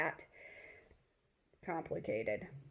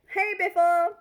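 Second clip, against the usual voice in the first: higher, louder call across the room; calling out to a friend; 10 semitones higher, 8 dB louder than usual. Retold or imitated. Lily calls out to her best friend across the room, higher and louder.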